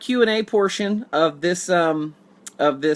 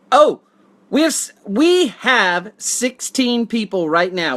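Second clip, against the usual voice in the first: excited; 4 semitones higher, 5 dB louder, slower than usual. Real, not acted. Excited at sixteen viewers.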